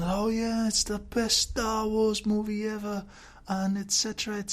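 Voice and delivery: mock the reviewers' breathless praise; mocking the hype. Vlogger parodying fans who praised The Force Awakens, in a mocking gush. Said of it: "and everyone said".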